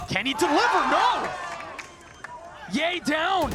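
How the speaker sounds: excited shout. Real, not acted. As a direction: shout the decisive kill. Caster calls the clutch failing.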